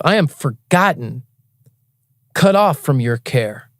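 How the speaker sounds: slow, grave Bible reading; solemn scripture reading. Acted. Reading Psalm eighty-eight to a drunk stranger, slow and solemn. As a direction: read the psalm slowly and heavily.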